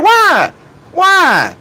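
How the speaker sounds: ordinary talk, nothing marked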